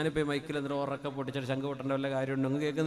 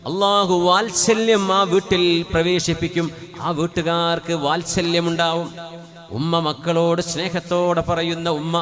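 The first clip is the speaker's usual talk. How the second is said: preaching at full force; 4 semitones higher, 10 dB louder than usual. Acted. Preacher to congregation on God's love for a home, loud preaching. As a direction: proclaim it, stretching the words.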